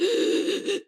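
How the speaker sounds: high, wheezy gasp; imitated wheezing. Child struggling to breathe on the drive, wheezing. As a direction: strain to drag air in, wheezing. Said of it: "like, wheezing like that".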